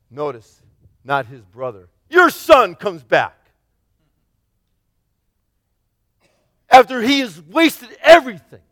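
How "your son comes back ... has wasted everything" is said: louder, higher bitter accusation; angry older son. Acted. Older son complaining about the returned brother, bitter and loud. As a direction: accuse the father of favouring the brother. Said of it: "just angry".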